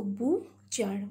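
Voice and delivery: "butcher" slowly split into bu and char; syllable by syllable. Shown as a demonstration. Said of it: "Bu is one syllable".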